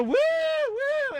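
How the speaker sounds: high, slow whooping; wild cheering women. Retold or imitated. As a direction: whoop like a rowdy crowd. Mimicking wild women at show.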